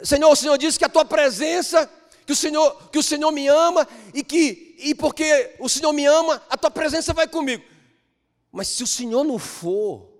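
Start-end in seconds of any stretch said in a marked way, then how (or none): 0.0-7.6 high, loud pleading to the Lord; Moses pleading. Acted. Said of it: "Lembra do Moisés?".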